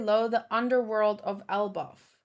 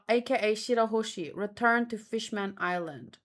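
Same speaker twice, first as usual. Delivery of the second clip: ordinary talk, nothing marked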